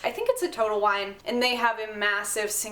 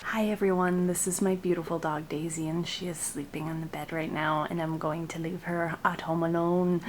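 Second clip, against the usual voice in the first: hushed; 5 semitones lower, 6 dB quieter than usual. Real, not acted. Dog sleeping, talking softly nearby.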